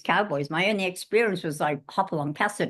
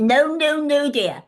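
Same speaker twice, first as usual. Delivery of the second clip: high, loud teacherly interruption; correcting teacher; 7 semitones higher, 5 dB louder, slower than usual. Retold or imitated. Teacher stopping a pupil's bad accent, loud correcting voice. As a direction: cut the pupil off, raised and insistent.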